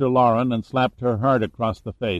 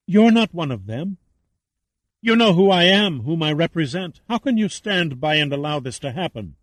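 higher-pitched, urgent plea; imploring; 5 semitones higher than usual. A narrator's reading. Captive Lauren appealing to Saracen to intervene, imploring. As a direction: plead in a raised, urgent voice. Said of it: "her eyes imploring".

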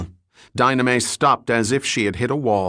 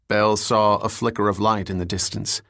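usual speaking voice

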